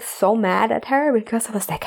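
ordinary delivery

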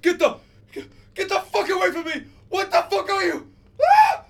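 high shriek at the top of the lungs; screaming. Acted. Prisoner woken and attacked in his cell, screaming. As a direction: scream frantically to fend off an attacker. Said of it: "starts to scream at the top of his lungs".

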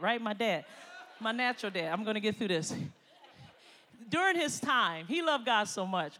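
ordinary delivery

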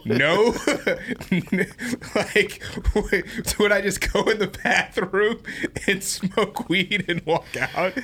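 high-pitched laughing speech; giggling while telling it. Real, not acted. Laughing at his own story.

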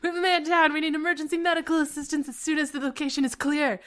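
radio for medics with drama; soap-opera soldier calling it in; urgent radio call. A narrator's reading. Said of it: "say into his walkie-talkie".

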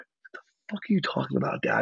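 breathless whispered disbelief; stunned whisper. Retold or imitated. Son to dad on the phone, stunned whisper. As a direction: hush it like you can't believe your ears. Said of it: "And I'm like".

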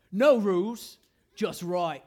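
mock Australian ad voice, first word loud; fake Australian accent. Pastor mimics the steakhouse ad in a fake Australian accent. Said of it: "this guy in this super fake Australian accent".

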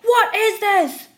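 high, loud rabid shout, second slow; furious customer shrieking; screaming. Retold or imitated. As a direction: scream it waving the cup. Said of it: "He screamed".

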